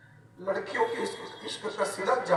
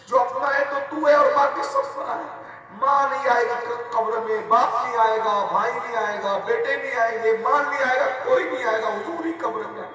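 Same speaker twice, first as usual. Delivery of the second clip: full-force preaching; 3 semitones higher, 6 dB louder than usual. Acted.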